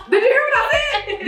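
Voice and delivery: squeaky laughing did you hear; high giddy plea. Retold or imitated. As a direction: beg her to listen, giggling. Retold pleading call to mom.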